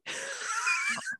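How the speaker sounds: high squealing burst of laughter; shrieking laugh. Real, not acted. Howls at own punchline.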